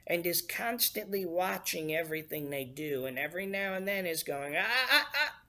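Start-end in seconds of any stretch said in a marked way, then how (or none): 4.5-5.4 high, loud mock tut-tutting; scolding stern parent. Preacher imitating how people picture God watching them, mock scolding. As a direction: play a watchful judge catching someone out, pitched up. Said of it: "more like a stern parent or judge".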